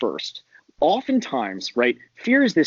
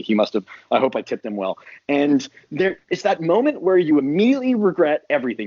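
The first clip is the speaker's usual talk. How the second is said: normal talking voice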